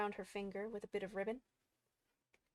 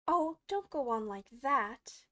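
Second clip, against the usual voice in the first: slow, louder plea; cried in despair; 3 semitones higher, 6 dB louder, slower than usual. A narrator's reading. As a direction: implore with drawn-out distress. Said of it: "cried the poor Queen".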